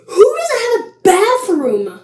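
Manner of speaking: high, loud, drawn-out disbelief; incredulous. Real, not acted. Vlogger reacting to silly game rules, incredulous. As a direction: stretch the question out high and loud.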